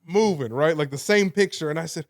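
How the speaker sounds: normal talking voice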